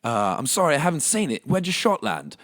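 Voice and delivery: loud, high Aussie bloke voice; Australian accent. Retold or imitated. Australian golfer to the narrator, loud Aussie accent. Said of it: "fucking Australian dude, I guess, um, just goes".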